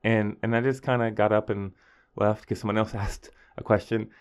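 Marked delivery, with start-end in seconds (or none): none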